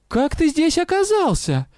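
very high-pitched outraged question; acted indignation, voice shooting up high; indignant cry. A narrator's reading. Witch demanding how he got here, indignant cry. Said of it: "Возмущённый крик".

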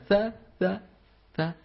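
ثاء with barely any sound on the consonant; weakly sounded letter. Shown as a demonstration. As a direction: mouth ثاء without really sounding it clearly. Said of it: "لكن وين الصوت؟". (ثاء بصوت ضعيف غير مبيّن)

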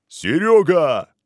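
loud, drawn-out yell of a name; yelling into the phone. Acted. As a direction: bellow the name down the line. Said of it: "заорал он в трубку".

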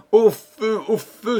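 high alarm call; cries of fire. A narrator's reading. Townspeople seeing flames on the walls, crying out. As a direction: raise the alarm, high and urgent. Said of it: "Des cris".

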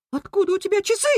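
very high-pitched outraged question; rat squeal. A narrator's reading. Rat confronting the rabbit over watches, squealing. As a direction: squeal it, rising at the end. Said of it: "взвизгнул Крыс".

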